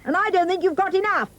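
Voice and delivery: very high defiant squeak; defiant piggy. A narrator's reading. Third pig defying the wolf, high and cocky. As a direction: cry it out like a cocky little pig. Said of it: "pig said".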